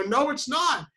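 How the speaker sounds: high-pitched retold protest; embarrassed denial. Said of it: "I'd been embarrassed".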